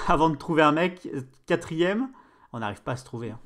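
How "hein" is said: usual speaking voice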